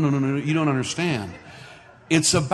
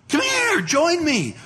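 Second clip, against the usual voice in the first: much higher, eager call across the room; eager invitation; 14 semitones higher, slower than usual. Retold or imitated. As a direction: beckon an old friend over, delighted. Man waves pastor over excitedly.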